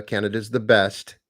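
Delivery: normal talking voice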